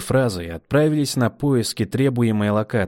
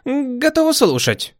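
eager; 4 dB louder than usual. Acted. Eager reply to commander.

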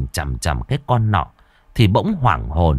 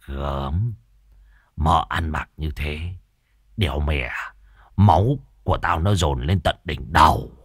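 put-on low old man's voice; 6 semitones lower than usual. A narrator's reading. Môn Phọt lecherously confiding to Lâm, low and hushed.

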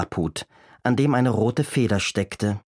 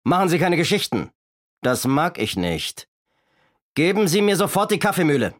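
threatening robber; 7 semitones higher than usual. A narrator's reading. Robber threatens and demands the mill.

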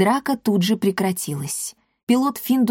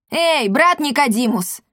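higher, raised call to a comrade; 6 semitones higher than usual. A narrator's reading. Pilot summons brother cat to help, calling out. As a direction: hail someone across the hangar. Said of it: "позвал Финдус".

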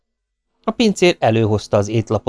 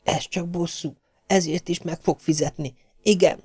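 soft, higher-pitched thought; inner monologue; 8 semitones higher, 6 dB quieter than usual. A narrator's reading. Maisie reassuring her own conscience, as quiet self-talk. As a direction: say it privately to yourself, softly and high. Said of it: "folyton azt mondta magában".

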